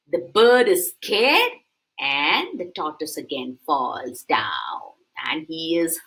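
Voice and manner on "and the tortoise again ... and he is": softly whispered narration. A narrator's reading. Storyteller whispering the tortoise's fall, hushed. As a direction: hush the fall.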